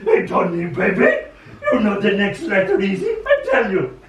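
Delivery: lightly voiced loud high puppet; Grover voice. Grover urging young Tony to learn letters, loud, lightly voiced. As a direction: play a brash muppet, a touch. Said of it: "and Grover said".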